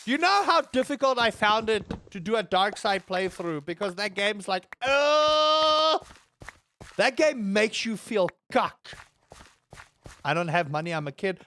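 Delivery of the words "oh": loud, high held "oh"; imitated cry. Gamer mimicking a dark-side playthrough, loud cry. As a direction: blurt a raised, drawn-out oh. Imitating what the game does.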